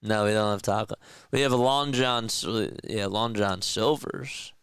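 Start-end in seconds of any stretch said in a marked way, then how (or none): none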